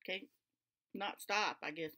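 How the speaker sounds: usual speaking voice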